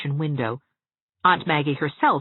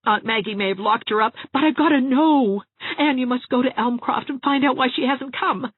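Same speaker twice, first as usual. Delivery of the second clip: higher-pitched, pressing plea to a friend; Jarvis pleading urgently; 11 semitones higher, 5 dB louder than usual. A narrator's reading. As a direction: urge her to go, voice pitched up.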